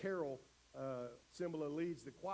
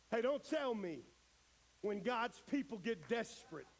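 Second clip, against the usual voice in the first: high, loud, impassioned sermon climax; full-force preaching; 9 semitones higher, 5 dB louder than usual. Real, not acted. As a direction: drive it home from the pulpit, voice raised.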